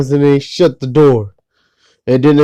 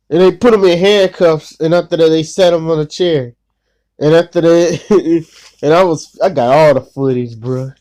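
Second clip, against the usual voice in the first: ordinary talk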